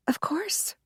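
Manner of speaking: breathy whispered reply, voice gone; a hushed, unvoiced of course. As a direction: answer in a bare whisper. Maid answers the visitors.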